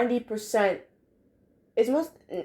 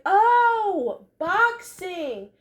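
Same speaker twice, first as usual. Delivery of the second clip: higher voice, a lightly voiced "oh, I get it"; sudden realization; 6 semitones higher, slower than usual. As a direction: act out a kid suddenly getting the joke. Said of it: "And I was like".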